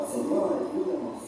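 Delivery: soft, low imitation of praying; acted quiet prayer. Preacher acting out a prayer at the altar, hushed plea. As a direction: voice a humble plea under the breath.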